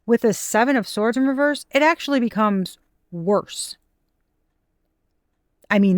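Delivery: usual speaking voice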